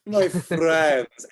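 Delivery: high, grand in-character greeting; effusive tavern hostess. Acted. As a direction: make an entrance with a sweeping welcome. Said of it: "comes up to your table and says".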